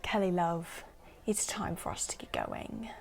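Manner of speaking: a hint of mock-British charm; British accent. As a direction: imitate a tipsy Brit, lightly. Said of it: "in his little British accent".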